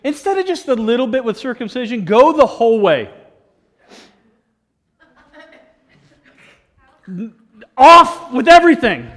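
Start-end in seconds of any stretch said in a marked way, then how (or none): 2.0-9.1 emphatic preaching. Real, not acted. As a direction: punch it home to the room. Preaching climax on eunuch.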